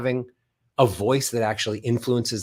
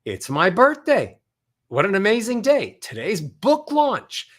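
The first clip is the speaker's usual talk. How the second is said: happy, climbing from low to high; ascending scales; 9 semitones higher, 3 dB louder than usual. Shown as a demonstration. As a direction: let each phrase soar up high. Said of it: "Going from a low note to a high note".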